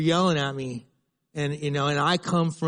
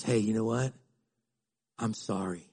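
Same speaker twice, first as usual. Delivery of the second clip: soft apology; 6 semitones lower, 6 dB quieter than usual. Retold or imitated. Voicing own apology after conflict.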